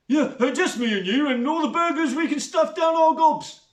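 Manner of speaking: high, loud goofy flirting; a lonely man flirting clumsily; Bert, smitten oaf. A narrator's reading.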